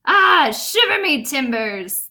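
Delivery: a lightly voiced pirate grumbling; mild hammed-up pirate lament; pirate exclaiming. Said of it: "the pirate said".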